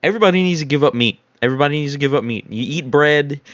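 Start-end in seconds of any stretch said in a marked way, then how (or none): 0.0-1.2 rushed. Retold or imitated. Rapid retold church decree. 1.4-2.5 very fast, tumbling decree; rapid-fire repeat. Retold or imitated. Repeated even faster.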